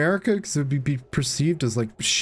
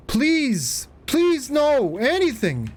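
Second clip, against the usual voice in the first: pleading; 10 semitones higher, much slower than usual. Real, not acted. Begging as character falls.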